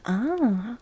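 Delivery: usual speaking voice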